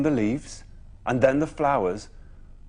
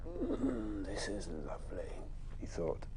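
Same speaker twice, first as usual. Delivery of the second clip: purring cat; 9 semitones higher, 12 dB quieter than usual. Acted. Wilbur the cat purring contentedly.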